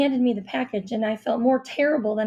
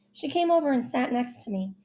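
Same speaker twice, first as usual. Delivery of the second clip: ordinary delivery, nothing marked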